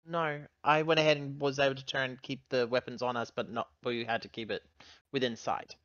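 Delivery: normal talking voice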